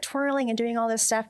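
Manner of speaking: ordinary talk, nothing marked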